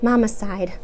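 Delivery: ordinary delivery, nothing marked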